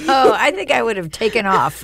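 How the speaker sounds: laughing, voice lifted in mock alarm; real amused laughing. Real, not acted. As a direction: react with a startled laugh. Laughing reaction to ghost voice story.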